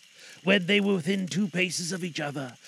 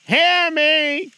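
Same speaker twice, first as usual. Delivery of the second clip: high loud commanding spectral cry; ghost crying out; 11 semitones higher, 10 dB louder than usual. Acted. Marley's ghost demands Scrooge listen. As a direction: call out like a desperate spirit. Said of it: "cried the ghost".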